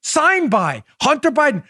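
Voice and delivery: high-pitched, emphatic rebuttal; exasperated insistence. Real, not acted. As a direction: hammer home the proof, exasperated. Exasperated display of the receipt.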